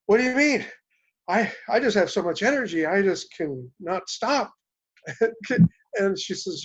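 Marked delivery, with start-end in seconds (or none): 0.1-4.5 excited. Retold or imitated. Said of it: "And I said". 5.1-5.7 a bright laugh, higher and louder; hearty laugh. Real, not acted. Laughing at his own story.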